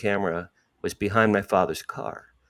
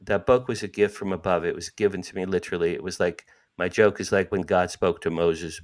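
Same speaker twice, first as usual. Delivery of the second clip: ordinary talk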